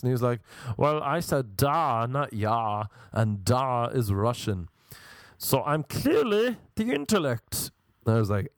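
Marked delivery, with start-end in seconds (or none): 0.8-7.7 theatrical know-it-all. Retold or imitated. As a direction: correct someone with exaggerated put-on grandeur.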